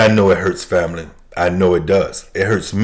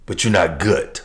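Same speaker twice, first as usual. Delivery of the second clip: ordinary delivery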